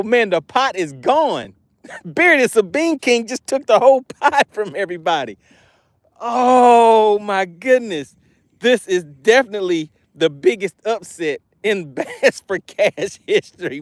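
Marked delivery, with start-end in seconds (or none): 2.0-13.8 talking through real laughter; laughing through words. Real, not acted. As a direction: laugh while announcing the upset. Laughing at the big upset.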